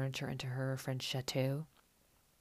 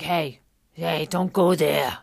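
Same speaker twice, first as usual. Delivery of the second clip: imitated angry yell; 15 dB louder, much slower than usual. Danielle snapping at her friends over her kids, imitated yell. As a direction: bark a warning to back off. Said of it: "yells at them".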